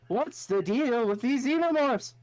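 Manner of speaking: slow, high Seinfeld observational whine; Seinfeld impression. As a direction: do a Seinfeld bit, drawn out. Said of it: "Seinfeld, so all I go".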